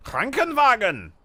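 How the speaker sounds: Krankenwagen bellowed high and slow; shouted German word. Shown as a demonstration. Streamer shouting Krankenwagen for chat. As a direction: yell Krankenwagen like an angry German. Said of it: "I love how you shout the words".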